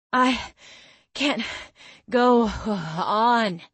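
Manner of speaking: panting out each word; out of breath. Acted. Breathless after the run.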